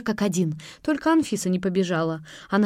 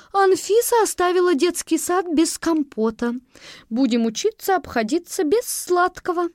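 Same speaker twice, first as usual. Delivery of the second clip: higher voice, mock-tragic; dismayed teacher; 10 semitones higher, 3 dB louder than usual. A narrator's reading. Teacher to children, higher dismayed voice. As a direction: lament the lost compote.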